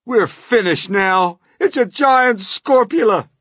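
high, loud wail of doom; panicked yell. A narrator's reading. Fire chief facing a giant spider, panicked yell. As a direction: yell as if all is lost. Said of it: "yelled the head of the fire department".

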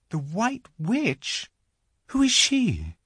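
high, innocent Lucy; puzzled little girl. A narrator's reading. Lucy asking the faun about the Witch, puzzled. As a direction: ask wonderingly in a high voice.

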